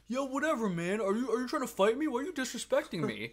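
retold drunk yelling at a teen usher; mock angry drunk. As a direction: act out a drunk guy itching for a fight, loud and high. Drunk snorer picks a fight.

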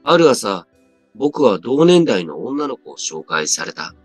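plain reading voice

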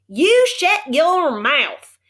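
very slow, loud, high cowboy yell; angry shout. A narrator's reading. Cowboy yells at Omri to shut his mouth. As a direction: bark each word at him. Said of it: "shouted the little man".

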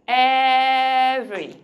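"Every" stretched long and loud as EV-ry; loud, slow "Every" in two syllables; drawn-out two-syllable every. Shown as a demonstration. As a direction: hold the first vowel of "Every", then a quick "ry". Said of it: "Did you say every".

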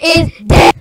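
loud robot-voice shout. Real, not acted. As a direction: yell through a robot voice changer. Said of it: "if you like this robot voice changer".

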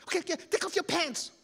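high, loud wail; frantic blubbering kid; crying. Acted. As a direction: urge it through acted tears. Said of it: "Keoki is crying".

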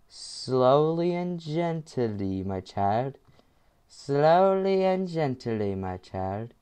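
put-on slow, soft coaxing like soothing a child; sanft flüsternd. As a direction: ease it out gently and slowly. Self-talk coaxing through hard part.

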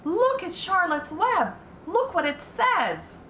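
put-on high, slow, thrilled child voice; excited girl. A narrator's reading. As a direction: point it out in wonder. Fern points at the web.

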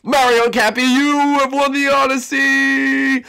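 high, loud winner announcement; jackpot winner. Acted. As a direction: announce the grand prize winner. Host announces the overall winner.

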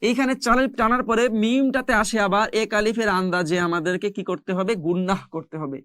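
usual speaking voice

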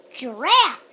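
loud straining grunt; effortful grunt. Acted. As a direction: heave like pulling hard. Witch straining at the pumpkin.

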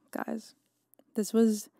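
sighed out breathy guys; deflated sigh to the listeners; weary sigh. Real, not acted. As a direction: let it out on a defeated sigh. Sighing over missed photo.

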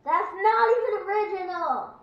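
high, very loud retort; indignant shout. Real, not acted. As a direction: yell back indignantly. Angry retort to the sarcasm.

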